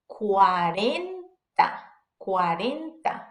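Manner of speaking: slow "cuarenta" with a soft tapped R. Shown as a demonstration. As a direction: lightly tap the R inside cuarenta. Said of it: "it's gonna be that soft R sound".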